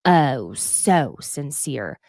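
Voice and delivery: slow, drawn-out sarcasm; sarcastic. Retold or imitated. To Dorit, mocking Sutton's slow sarcastic retort. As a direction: drag out "sincere" with contempt. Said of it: "'Cause Sutton goes".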